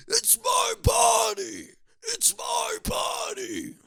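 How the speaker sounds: high, slow shrieking yell of "it's my body"; mock scream. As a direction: screech it like a hammed-up tantrum.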